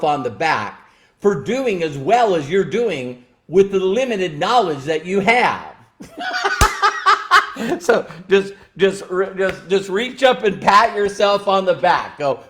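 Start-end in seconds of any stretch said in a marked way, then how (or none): 6.0-7.7 short high burst of laughter; bright laugh after the joke; real laugh. Real, not acted. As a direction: let a laugh break out high. Laughs at own point.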